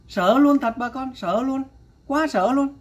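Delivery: alarmed pitch climbing on each repeat; real shocked alarm. Real, not acted. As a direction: sound truly scared by what you heard. Reacting in shock to clip.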